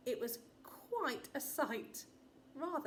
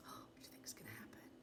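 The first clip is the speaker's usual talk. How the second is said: quick hushed whisper, much softer; fast whispered aside; 12 semitones lower, 15 dB quieter, much faster than usual. Acted. Narrator asking the children in a rushed whisper. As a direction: lean in and whisper the question fast.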